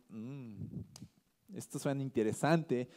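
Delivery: low, soft musing with a pause after the Mm; quietly eye something valuable, mildly intrigued; tempted musing. Retold or imitated. People spotting valuable livestock to keep, tempted musing. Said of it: "lo que veían valioso decían".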